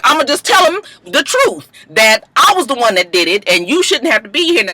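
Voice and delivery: a high, earnest old mama voice, lightly played; pleading to take the blame; mama's confession. Mama tells the cop she did it, high and earnest.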